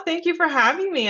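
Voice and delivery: usual speaking voice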